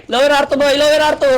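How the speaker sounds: high, pressing call to the team; urgent callout. Real, not acted. Squad leader sending teammates to a position, urgent callout. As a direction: call it out urgently, pitched well up.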